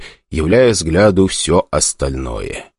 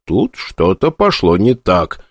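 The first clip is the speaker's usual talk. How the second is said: ordinary delivery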